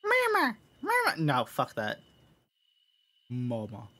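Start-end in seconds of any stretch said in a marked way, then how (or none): 0.0-1.2 put-on very high squeaky toddler; extremely high little-kid voice; tot voice. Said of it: "What's a good voice for this tot?". 3.3-3.9 tot voice try-out. Acted. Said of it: "What's a good voice for this tot?".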